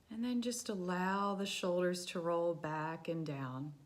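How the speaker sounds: soft, hushed meditation voice; quiet, settling body-scan narration; guided meditation. Real, not acted. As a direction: guide them gently through a body scan, softly and with long pauses.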